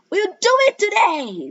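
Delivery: high, loud excited cry. Acted. Classmates cheering the plan, high excited cry. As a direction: cry it out like thrilled kids. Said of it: "they cried".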